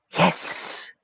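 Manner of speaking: soft whispered hiss; hissing snake. A narrator's reading. Mage turned snake answering Palin, hissing. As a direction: stretch the s into a hiss. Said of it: "hissed a snake".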